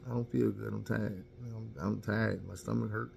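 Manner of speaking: dodging his wife with soft sick excuses; weak excuses. Retold or imitated. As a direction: fake being too ill, mumbling softly. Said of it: "And I'm like".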